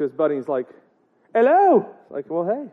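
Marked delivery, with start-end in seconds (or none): 1.3-1.9 high Irish greeting; mock Irish accent. Seamus greets his buddy in the street, mock Irish accent. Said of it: "I'm gonna get that accent". 2.3-2.7 lightly put-on Irish accent, still searching for it; attempted Irish accent. Two buddies meet on the street, attempted Irish accent. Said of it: "I'm gonna get that accent".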